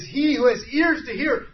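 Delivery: loud, high pleading cry; impassioned cry. Acted. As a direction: cry it out to every listener. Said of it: "It's to cry out loudly".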